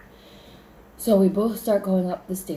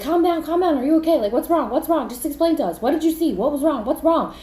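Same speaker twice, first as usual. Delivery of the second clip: high, loud, urgent questioning; frantic concern; 9 semitones higher, 6 dB louder, faster than usual. Retold or imitated. Friends trying to calm her, frantic concern. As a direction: pile up the questions in alarm.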